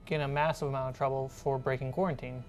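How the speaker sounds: usual speaking voice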